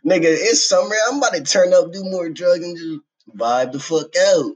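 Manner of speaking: high, loud, carefree; hyped party shout. Retold or imitated. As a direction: shout it like summer's here. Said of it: "I'm just like".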